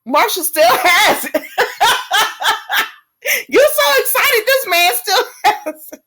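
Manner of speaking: high, giggling through the words; laughing with real delight; laughing with joy. Real, not acted. Laughing happily at Marshall's good news, laughing.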